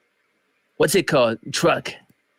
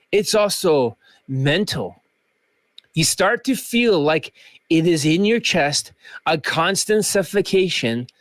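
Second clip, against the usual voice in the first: normal talking voice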